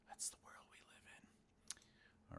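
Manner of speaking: soft, slow whispered aside; hushed half-whisper to herself. Real, not acted. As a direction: breathe it out as a private thought. Musing aloud over her book.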